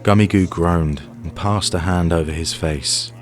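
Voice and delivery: usual speaking voice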